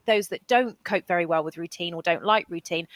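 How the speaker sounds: ordinary delivery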